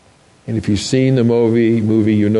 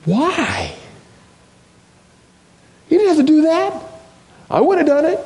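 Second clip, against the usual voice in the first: much higher, pleading and pained; anguished plea. Acted. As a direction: cry out in disbelief. Anguished question to congregation.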